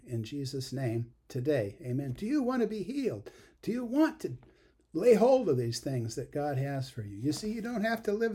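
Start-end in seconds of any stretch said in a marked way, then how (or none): 2.1-8.4 fervent preaching. Real, not acted. Preacher calling out to congregation.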